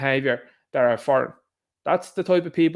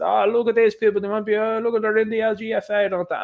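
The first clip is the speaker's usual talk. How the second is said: higher mock-gossipy voice pointing and gawking; playfully imitating a sensationalist reporter; mocking the media; 7 semitones higher than usual. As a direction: parody the media's breathless pointing, pitched up. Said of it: "They've put them out and said".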